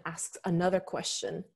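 ordinary delivery

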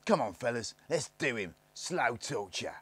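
lightly voiced rough boys jeering; a hint of bullying kids calling for torture; Snargits crying out. A narrator's reading. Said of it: "they cried".

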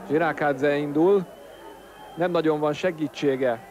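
ordinary talk

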